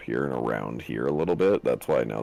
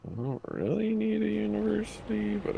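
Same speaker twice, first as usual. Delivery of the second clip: high squeaky voice. Pretend. High far voice.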